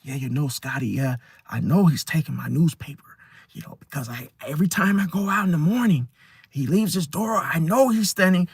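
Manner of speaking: lightly voiced paranoid neighbour, hushed; over-explaining. A lightly voiced neighbour over-explaining, hushed. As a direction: ramble a hushed, suspicious story. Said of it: "over-explain bullshit".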